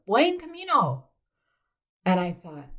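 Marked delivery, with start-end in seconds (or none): 0.1-1.0 high, lilting call of a passing woman; imitating a woman calling out nearby; mock pilgrim greeting, sung out high; closer, called greeting. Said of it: "then I hear a little closer".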